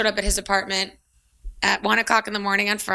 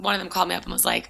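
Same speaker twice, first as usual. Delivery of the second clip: ordinary talk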